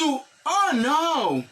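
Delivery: lightly acted dismayed oh no; acted reply. Shown as a demonstration. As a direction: play the dismayed answer. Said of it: "M di". (yon ti repons dezole)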